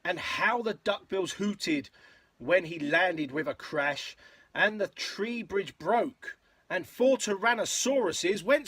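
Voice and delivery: lilting, rhythmic picture-book read; story narration. A narrator's reading. Parent reading a dinosaur rhyme to a child, story narration. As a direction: read in bouncy verse rhythm.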